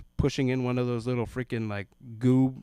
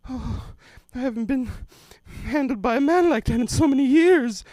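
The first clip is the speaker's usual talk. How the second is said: put-on high, louder swooning old lady; 13 semitones higher, 8 dB louder than usual. Elderly lady swooning at being held, high and loud. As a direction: swoon it like a tickled granny.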